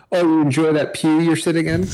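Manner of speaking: teasing preacher voice. Retold or imitated. Voicing the pastor teasing congregation.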